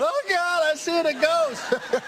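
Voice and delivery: high-pitched, drawn-out mock scream; hammed-up high shriek of fright. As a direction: squeal it like a spooked audience seeing a ghost. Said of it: "his scream".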